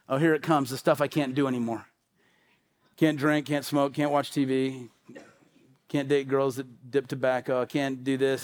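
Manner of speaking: low, subdued mock-dreary list; imitating a resigned churchgoer, quiet and low; glum resignation. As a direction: recite the forbidden things in a dreary undertone. Voicing churchgoers dreading holiness rules.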